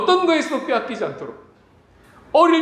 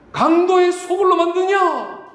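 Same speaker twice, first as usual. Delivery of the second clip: slow, loud angry rebuke; Jesus shouting; 8 semitones higher, 4 dB louder, slower than usual. Retold or imitated. Jesus rebuking temple traders, slow loud angry cry. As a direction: drag out the accusation with rising fury. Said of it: "소리치며 채찍질을 하셨습니다".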